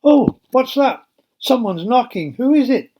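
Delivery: jolted awake by a knock, alarmed; startled. Acted. Rhoda, woken by a knock at night, startled and high. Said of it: "Rhoda woke up with a start".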